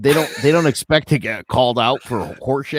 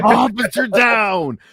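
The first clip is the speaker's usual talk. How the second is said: high, loud, drawn-out mock distress call; hammed-up emergency shout; officer calling for backup; 11 semitones higher, 4 dB louder, slower than usual. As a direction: holler for help like a cop under fire. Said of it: "I tend to walk the beat alone".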